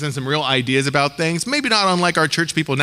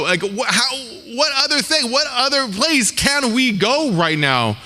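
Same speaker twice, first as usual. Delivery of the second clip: pleading, pitched much higher; mock urgent, where-else-could-we-go plea; desperate Peter; 6 semitones higher than usual.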